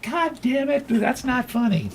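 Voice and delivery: annoyed wife. Retold or imitated. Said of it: "And she's like".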